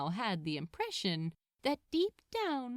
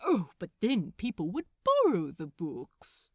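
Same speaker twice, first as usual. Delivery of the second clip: louder, playful banter; animated dialogue voices; 5 dB louder than usual. Acted. Crowley and Aziraphale banter, loud and playful. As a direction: tease with open amusement.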